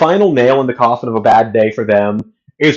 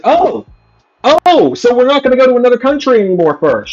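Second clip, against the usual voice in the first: high, fast mock astonishment; incredulous mock gasp; sarcastic surprise; 10 semitones higher than usual. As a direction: act shocked at the news, overdoing it.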